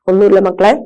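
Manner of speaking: ordinary talk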